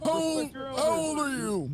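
put-on high, slow old man; old guy voice. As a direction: croak it like an old geezer. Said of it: "Old guy voice R P".

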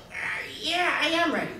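loud, high, hammed-up eagerness; eager buyer. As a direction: blurt out a big, high-pitched yes. Acting out a buyer agreeing to deal.

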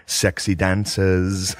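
slow, stretched-out quote; drawn-out excitement. Retold or imitated. Telling what Sam said to the camera, slow and drawn out. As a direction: imitate him savoring the words, very slowly. Imitating pumped man to camera.

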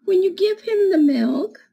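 put-on reading to children. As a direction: read it like storytime for little kids. Reads picture book to children.

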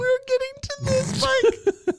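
very high, laughing squeal; laughing protest. Real, not acted. Host to co-host jumping ahead in the backstory, laughing protest. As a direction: squeal it through helpless laughter. Protests at co-host's blunt interruption.

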